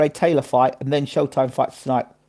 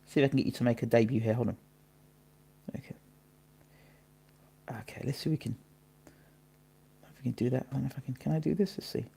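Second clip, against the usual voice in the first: talking to oneself; 13 dB quieter, faster than usual. Real, not acted. Streamer trying to get the cat on camera, soft self-talk. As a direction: murmur it while busy with something.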